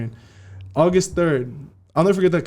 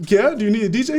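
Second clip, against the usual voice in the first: higher, eager offer; 8 semitones higher, 3 dB louder, faster than usual. Retold or imitated. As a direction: jump at the chance.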